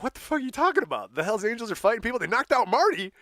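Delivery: high, fast, panicked retelling; alarmed bandmates. Retold or imitated. Stagehands telling the band about the fight, high and fast. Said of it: "They're like".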